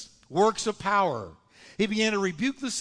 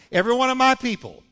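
louder, faster, higher, with stress on "my"; emphatic repeat; 5 semitones higher, 5 dB louder, faster than usual. Shown as a demonstration. Preacher driving the point home to the congregation with a loud, fast call. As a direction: punch out "Every one of my people".

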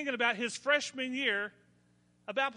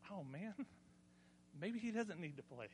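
quiet worried thought; 3 semitones lower, 14 dB quieter than usual. Retold or imitated. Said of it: "I thought".

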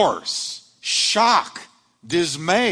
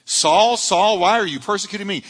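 calling out; 6 semitones higher, 5 dB louder than usual. Acted. Voicing Jesus calling Saul.

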